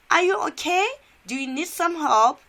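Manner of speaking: high, loud worried question; acted high-voiced concern; concerned jogger. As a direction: ask in alarm, pitched right up.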